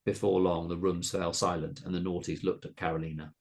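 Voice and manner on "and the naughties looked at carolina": usual speaking voice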